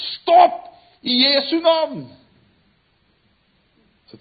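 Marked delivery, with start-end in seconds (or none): 0.1-2.0 high, loud scream, drawn out; screaming stop. Acted. Monk screaming from the arena floor, a full shout. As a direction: scream it to halt the killing. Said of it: "og så skriker han".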